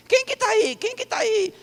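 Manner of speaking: put-on high-pitched, startled old man's voice. Near-deaf old pastor woken with a fright, startled old man. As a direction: cry out high, jolted from sleep. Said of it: "tomou assim um susto e virou".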